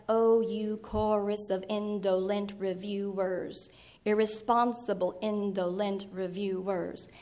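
slow, stressed syllables hammered in a steady beat; slow metrical reading aloud of English verse; reading in meter. Shown as a demonstration. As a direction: lean on every stressed syllable of the line. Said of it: "and be something like".

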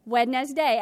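"Wednesday" in three loud, separate syllables, wed-nes-day; spelling pronunciation. Shown as a demonstration. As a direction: sound out every written letter of "Wednesday": WED-NES-DAY. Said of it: "think differently to spell a word than how we actually pronounce it".

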